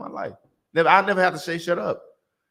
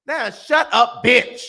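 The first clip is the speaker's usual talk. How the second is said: loud harsh command; 5 semitones higher, 5 dB louder, much slower than usual. Retold or imitated. Escalating the command.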